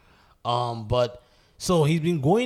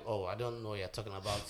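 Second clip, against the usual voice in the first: much softer feigned ignorance; quiet denial; 2 semitones lower, 12 dB quieter than usual. Retold or imitated. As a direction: imitate someone blandly playing dumb. Retold Putin denying again.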